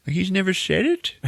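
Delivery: high-pitched pitying question; mock disbelief. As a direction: squeak it out, incredulous. Villains react in disbelief.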